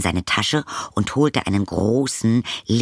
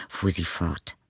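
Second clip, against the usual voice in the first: usual speaking voice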